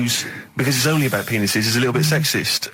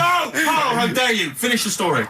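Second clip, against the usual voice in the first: mock outraged shout; 9 semitones higher than usual. Cohost to Carl for cutting the story short, hammed-up outraged shout. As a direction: cry out in pretend outrage.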